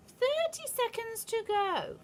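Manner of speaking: high, slow gloating witch cry. A narrator's reading. Witch counting down to the crowd, high and gloating. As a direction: count down with cruel glee. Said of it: "cried the Grand High Witch".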